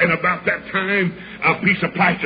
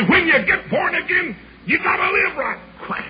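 Duck; 11 semitones higher than usual. Retold or imitated. Young evangelist mimicking his old pastor, duck-like preaching. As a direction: preach it way up high, then a short quack. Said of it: "he'd preach like a duck".

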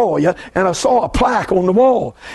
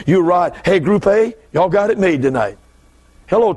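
normal talking voice

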